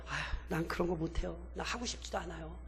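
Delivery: low, very soft acted reluctance; reluctant refusal. Gideon refusing the call to go to war, timid and quiet. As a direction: shrink back and murmur the refusal.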